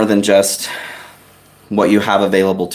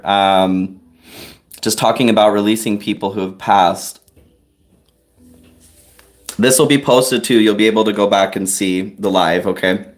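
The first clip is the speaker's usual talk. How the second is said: ordinary talk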